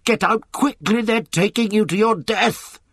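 high, frantic warning; Clover's terrible cry. A narrator's reading. Mare warning Boxer inside the van, desperate cry. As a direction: plead urgently, pitch held well up. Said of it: "cried Clover in a terrible voice".

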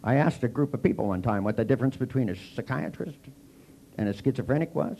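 ordinary talk

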